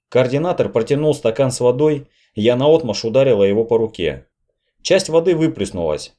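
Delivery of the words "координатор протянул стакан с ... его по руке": plain reading voice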